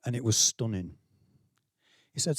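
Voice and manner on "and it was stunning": ordinary talk, nothing marked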